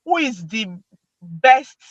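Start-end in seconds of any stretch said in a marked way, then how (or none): none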